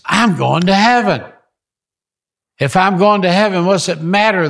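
high, loud burst of sudden joy; joyful exclamation. Retold or imitated. Gunman in standoff suddenly realizes he's saved, overjoyed exclamation. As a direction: blurt it out, overjoyed at the revelation. Kaiser suddenly accepts heaven, surrendering.

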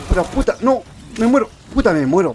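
high, loud cries of alarm; panicked cursing. Real, not acted. Player about to die in game, panicked cursing. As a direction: curse desperately at full voice.

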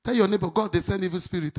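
ordinary talk

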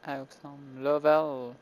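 awesome read in a thick French accent, quietly; French-accented English. Shown as a demonstration. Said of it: "je sais pas mon accent anglais". (accent anglais maladroit à la française)

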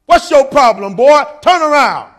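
a general yelling at a soldier who failed to salute; general's yell. Retold or imitated. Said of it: "and yelled at me".

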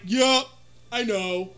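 normal talking voice

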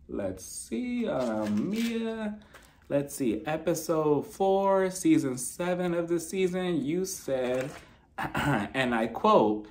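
soft, slow mock reading from notes; checking notes. Host pretends to check notes on Mia, soft and drawn out. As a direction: murmur it slowly while pretending to search.

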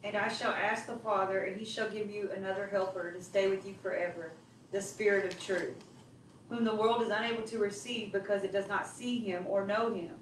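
ordinary talk, nothing marked

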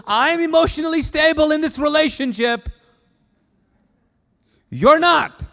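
high, loud, exasperated insistence; God's emphatic retort. Acted. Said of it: "And he goes".

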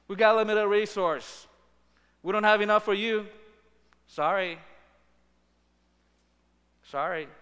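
a loud, brisk brush-off, then a clipped sorry; mock dismissive refusal. Preacher mocking people who turn others away, brushing off. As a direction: wave off the needy like a gatekeeper. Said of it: "The same logic that we apply".

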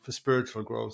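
ordinary talk, nothing marked

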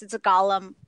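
ordinary talk, nothing marked

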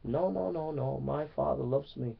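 soft, steady no to the devil; hushed, trusting reply to the inner voice; firm quiet refusal. Retold or imitated. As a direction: quietly push the voice away, calm and sure. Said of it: "you say".

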